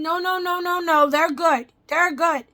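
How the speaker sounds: loud, high dismissive no no no; imitating a dentist who yells at her; mocking the rude dentist. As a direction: bark the dentist's brush-off loudly. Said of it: "He come in".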